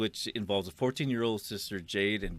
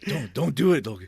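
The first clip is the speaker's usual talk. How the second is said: imitating a worried friend, higher and louder; mock freaked out; 6 semitones higher, 10 dB louder than usual. Retelling a friend begging not to be embarrassed, mock freaked out. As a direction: plead in a half-laughing panic. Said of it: "you got all freaked out".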